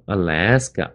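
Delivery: "Alaska" as uh-LASS-kuh, weight on LASS; light first vowel, strong middle in "Alaska"; stress on second syllable. Shown as a demonstration. Teacher showing where Alaska is heaviest, "Alaska" stressed on LASS. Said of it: "这个来的地方最重".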